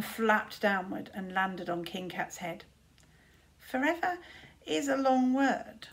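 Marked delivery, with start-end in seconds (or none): 3.6-5.9 ominous raven. A narrator's reading. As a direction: let forever hang ominously.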